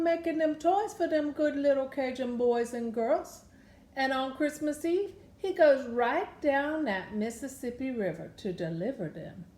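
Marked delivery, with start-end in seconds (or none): none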